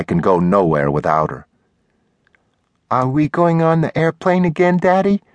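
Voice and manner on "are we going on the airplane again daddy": put-on high-pitched kid's question; little girl. A narrator's reading. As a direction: voice a little girl asking her daddy, pitched way up. Little daughter asks her father.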